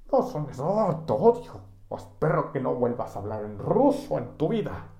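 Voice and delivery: high, loud mock exasperation; hammed-up "te odio"; mock fury. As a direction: play at losing your temper. Co-host mock rage.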